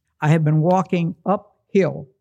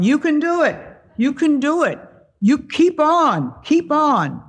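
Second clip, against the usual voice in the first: cheering; 10 semitones higher than usual. Retold or imitated. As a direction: cheer her on up the rock. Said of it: "and they're going".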